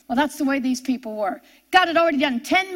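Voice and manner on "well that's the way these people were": ordinary delivery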